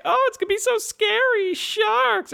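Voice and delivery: high mock-scared squeal; scared tourist. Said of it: "anyone who goes on it like".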